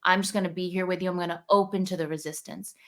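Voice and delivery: ordinary talk, nothing marked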